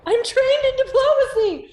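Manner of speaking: excited boast. Real, not acted.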